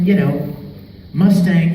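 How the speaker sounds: usual speaking voice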